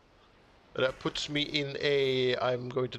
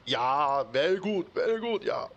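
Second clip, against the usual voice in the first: hammed-up German accent, higher and louder; bad German accent; 3 semitones higher, 3 dB louder than usual. Game builder hamming up a German accent over his build. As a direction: overdo a German accent while praising. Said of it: "my bad German accent".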